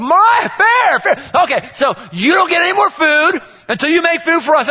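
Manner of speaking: hammed-up father scolding daughter; mock stern dad. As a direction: lay down the law with playful sarcasm.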